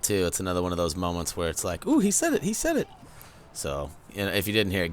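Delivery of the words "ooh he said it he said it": excited squeal. Real, not acted. Excited fan reaction.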